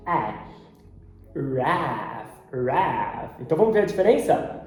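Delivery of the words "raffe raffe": American ash vowel in the syllable raf; low, drawn-out American raef; American accent. Shown as a demonstration. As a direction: front A, the American way. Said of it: "Já no americano, eles usam o ash".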